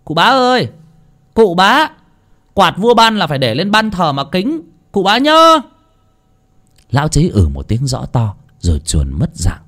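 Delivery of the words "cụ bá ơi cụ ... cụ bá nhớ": much higher, louder call across the yard; yelling from afar. Acted. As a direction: shout after the old man as he leaves. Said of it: "thằng Bờm đã gào lên".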